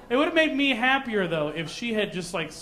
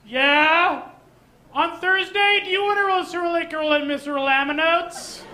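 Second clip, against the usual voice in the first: higher, louder call with gibberish at the end; calling to another room; 8 semitones higher, 4 dB louder, slower than usual. Pretend. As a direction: yell it across the house. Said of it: "Let me give you an example".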